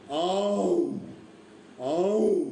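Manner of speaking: drawn-out tiger roar, swelling and falling; long mimicked big-cat bellow; imitated tiger roar. Shown as a demonstration. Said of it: "पण त्याची आरोळी असते".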